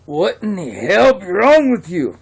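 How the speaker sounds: a shocked drinker yelling across the bar; outraged shout. Acted. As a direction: bellow it at the man who just spat on you. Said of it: "And the guy's like".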